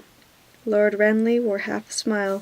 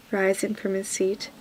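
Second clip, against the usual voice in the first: plain reading voice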